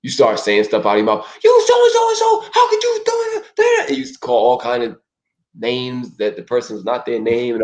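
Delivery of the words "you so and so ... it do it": high shouted insult; mock furious yell. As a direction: screech an angry accusation. Acted insulting outburst.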